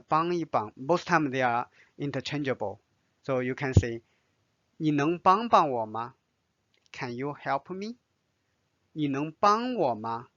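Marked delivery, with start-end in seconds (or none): none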